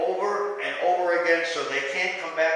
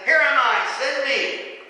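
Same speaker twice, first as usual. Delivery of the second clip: ringing, higher-pitched eager answer; eager volunteer; 8 semitones higher, 7 dB louder, slower than usual. Acted. Preacher voices Isaiah answering God's call, eagerly. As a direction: jump on it, high and loud. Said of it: "Isaiah jumped on it right there".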